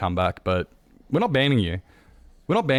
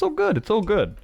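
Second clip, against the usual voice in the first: much higher, bouncy cheerful greeting; excited. Real, not acted. Thanking a raider for coming in, excited and high. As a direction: beam through the thanks.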